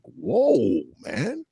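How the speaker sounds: high, drawn-out stunned whoa; shocked whoa. Retold or imitated. Reacting to hateful comments, a high shocked whoa. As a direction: recoil in disbelief. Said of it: "you look at that shit like".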